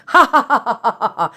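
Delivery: a hammed-up villain cackle at Doctor Lori; playful evil laugh that fades out; mock evil laugh. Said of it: "I'm not that evil".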